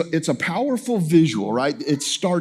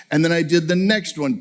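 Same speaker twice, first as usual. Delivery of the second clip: ordinary talk, nothing marked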